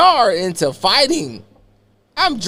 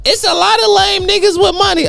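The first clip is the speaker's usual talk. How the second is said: much higher, mocking sass; Kenya's retort; 14 semitones higher than usual. Kenya sassing him about money, high mocking voice. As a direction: toss it off high and snide. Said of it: "She like".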